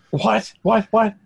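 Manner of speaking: high, stunned what; can't believe what you just heard; disbelief. Retold or imitated. Retelling his shock at news.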